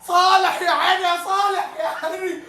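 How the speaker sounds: high, laughing praise for the scorer; real joyful fan praise; overjoyed cheering. Real, not acted. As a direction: gush with delight. Praising the scorer after goal.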